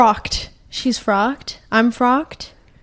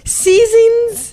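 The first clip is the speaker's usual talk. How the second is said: high, cracked festive call; voice cracking; 9 semitones higher, 3 dB louder than usual. Real, not acted. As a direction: voice breaks upward mid-greeting. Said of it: "did you hear my voice crack".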